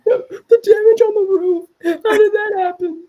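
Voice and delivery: high, loud question through laughter; losing it laughing while pointing out the absurdity; helpless, incredulous laughing talk; cracking up. Real, not acted. Cracking up at video damage.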